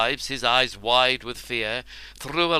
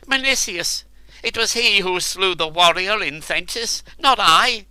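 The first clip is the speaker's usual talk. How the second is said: panicked pleading; 9 semitones higher, 8 dB louder than usual. A narrator's reading. Terrified man blames another.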